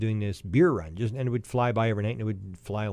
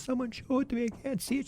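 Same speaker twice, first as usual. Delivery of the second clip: much higher, pleading mock sob; imitating crying girl; 13 semitones higher, 5 dB quieter than usual. Teller imitating the crying girl in the car, high pleading. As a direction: voice the girl who can't see it, high and tearful. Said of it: "And she's crying".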